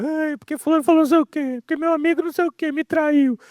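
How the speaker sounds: mock a man who complains all the time; whiny crybaby. Pastor mocking a crybaby man's complaints, high whine. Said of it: "o homem chorão é um Zé Bunda".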